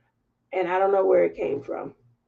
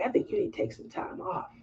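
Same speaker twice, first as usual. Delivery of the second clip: soft whispered, quick advice; a coworker quietly telling her to take time off; hushed quote; 8 semitones higher, 12 dB quieter, faster than usual. Retold or imitated. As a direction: breathe it gently, almost secretly. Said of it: "she was like".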